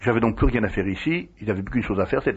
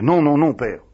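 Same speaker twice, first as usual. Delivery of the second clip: protestation suppliante; 6 semitones higher, 5 dB louder than usual. Retold or imitated. Pénitent chassé qui proteste.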